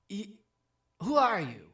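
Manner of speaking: loud, high challenge; incredulous innkeeper outburst; baffled dwarf. Acted. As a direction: demand it in loud disbelief.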